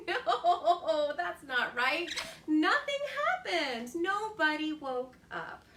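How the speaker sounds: amused, playful correction; laughing. Real, not acted. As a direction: laugh through the words. Laughing at rooster's mistake.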